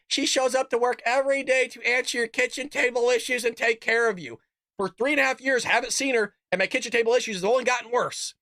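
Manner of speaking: high, sneering parody of a news anchor; mocking media voice. Host mocking the media's claims about Kamala, high mocking parody. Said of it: "the media will say".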